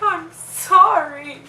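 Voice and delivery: loud, high, drawn-out mock wail; re-enacted distressed cry, loud and slow; retold screaming apology. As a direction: wail the apology, stretched right out. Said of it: "Screaming like".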